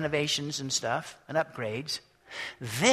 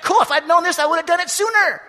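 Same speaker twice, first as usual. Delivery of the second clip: delighted; 12 semitones higher, 9 dB louder, faster than usual. Retold or imitated. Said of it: "It's like".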